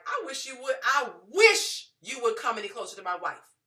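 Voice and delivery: loud menacing warning, imitating husband; threatening husband. Husband warns vendors off his wife, loud menacing. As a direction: dare them to come closer. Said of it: "my husband was like".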